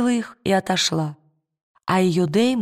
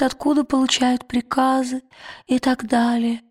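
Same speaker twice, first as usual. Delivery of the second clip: higher daemon voice; 4 semitones higher than usual. Acted. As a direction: lift the voice for the ferret daemon. Ferret daemon speaks for dying man. (голос хорька, выше и медленнее)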